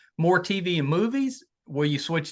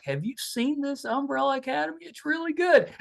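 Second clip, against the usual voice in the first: much higher, eager teen voice, mildly hammed; imitating his son's excitement; 11 semitones higher than usual. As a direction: play the son gushing about a show he once refused. Son retells show as new find.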